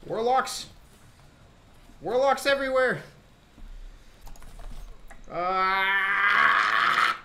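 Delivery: high panicked yelling; raging gamer. Real, not acted. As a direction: scream at the swarm of enemies. Ambushed by enemies, dying.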